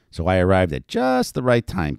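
ordinary delivery

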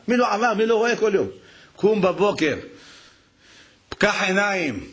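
high, impassioned preacher's call; fervent preaching. Acted. Rabbi urges listeners to turn to God, preaching fervently. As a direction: rouse the congregation.

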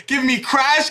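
ordinary delivery

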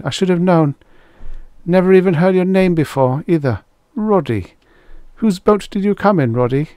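put-on high-pitched, chatty girl's voice; high, lively character voice; friendly girl. A narrator's reading. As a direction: make friendly small talk as a girl. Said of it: "her whisper was friendly enough".

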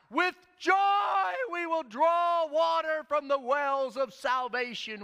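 high, loud, slow joyful shout; crowd's cry. Retold or imitated. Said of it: "the crowd would cry out".

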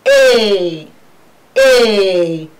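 A drawn out loud, landing on "ee"; long falling A, twice; diphthong ending demo. Shown as a demonstration. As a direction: stretch A as "eyyy" to expose the final ee. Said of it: "The end of these diphthongs is E".

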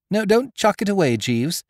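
put-on breezy young master; young gentleman. A narrator's reading.

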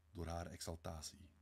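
usual speaking voice

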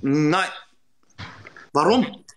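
ordinary talk

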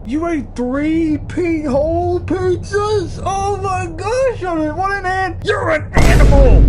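high, slow disbelief building to a shout; shocked shout. Real, not acted. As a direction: build from gasp to a yell. Shocked at three pizzas.

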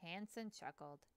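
ordinary delivery

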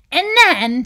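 excited character bursting in. A narrator's reading. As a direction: jump in with an eager plan. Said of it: "said Anger excitedly".

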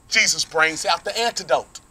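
normal talking voice

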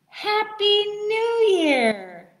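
high, slow, cheering "Happy New Year"; new year countdown crowd. Shown as a demonstration. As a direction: cheer it out at midnight. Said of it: "Should we practice counting down to the new year?".

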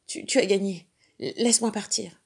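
trembling plea. Acted. Beaten panther begging the dog to let her go. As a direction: beg for mercy, voice trembling. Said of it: "Sa voix tremblante supplia".

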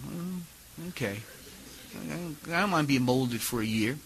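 soft, mild mock reluctance; low, understated grudging okay; subdued acceptance. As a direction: give in quietly, as if retold.

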